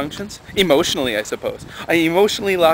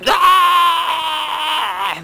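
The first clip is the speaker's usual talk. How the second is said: high mock child shriek; kids screaming; 5 dB louder than usual. Teacher imitating kids running wild in class, high shriek. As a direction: shriek like a wild five-year-old.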